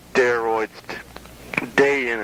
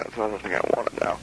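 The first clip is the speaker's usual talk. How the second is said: ordinary delivery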